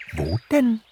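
very high-pitched bird question; chirping little swallow. A narrator's reading. Little swallow asks Pixie where, chirping. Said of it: "zwitscherte eine kleine Schwalbe".

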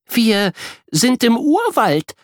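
put-on a girl's excited discovery. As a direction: reveal the jungle with thrilled awe. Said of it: "sagt sie aufgeregt".

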